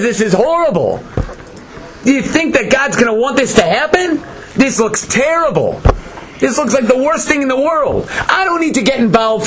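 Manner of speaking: high-pitched mock protest; complaining. Satan objecting to what he sees, complaining in a high voice. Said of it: "The Satan starts complaining".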